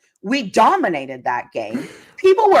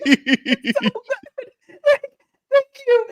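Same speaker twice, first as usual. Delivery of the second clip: much higher, very slow, laughing drawl; 13 semitones higher, much slower than usual. Real, not acted. Host delighting in the wins, laughing. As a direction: stretch it out while laughing.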